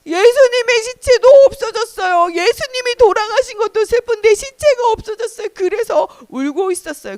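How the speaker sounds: high-pitched, whining cry; acted weeping explanation; tearful lament. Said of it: "뭐 때문에 울고 있어요".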